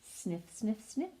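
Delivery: soft little sniffing words; small, quiet sniffs rising at the end; mouse sniffing. Acted. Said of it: "and sniffed some more".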